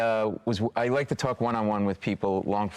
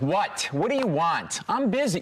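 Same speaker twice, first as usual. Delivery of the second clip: mock rude brush-off; 7 semitones higher, slower than usual. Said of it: "I'm like".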